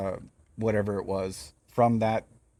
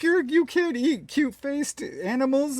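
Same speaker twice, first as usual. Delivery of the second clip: mock scold; 14 semitones higher than usual. Mocking scolding public.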